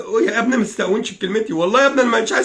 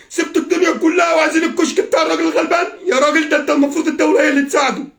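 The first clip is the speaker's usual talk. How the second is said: high, distraught protest; real upset, raised-pitch reproach; anguished indignation; 7 semitones higher, faster than usual. Real, not acted. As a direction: plead the poor man's case, near tears. Indignant over the poor man.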